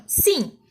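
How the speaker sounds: "sim" said as one single syllable, unbroken; all in one piece. Shown as a demonstration. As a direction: give "sim" in one firm beat. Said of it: "É tudo junto, a gente fala assim".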